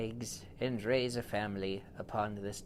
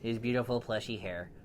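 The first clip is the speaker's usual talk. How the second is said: ordinary delivery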